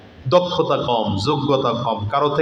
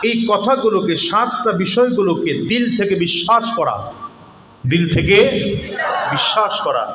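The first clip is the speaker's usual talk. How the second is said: raised, rousing sermon voice with shouted peaks; fiery waz preaching; 4 semitones higher than usual. Acted. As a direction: preach it out to the crowd with rising force. Preacher builds to full force.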